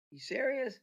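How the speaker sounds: high, startled "You serious?"; stunned disbelief. Retold or imitated. Taken aback by Pat's offer, high surprised question. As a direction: ask it like you can't believe the offer. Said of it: "I'm like".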